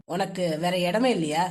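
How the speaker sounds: low, irritated man's voice; low, hissed-out reproach; snappish. A narrator's reading. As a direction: snap the question at her in a low voice. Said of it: "சீரலாக வந்தது கேள்வி".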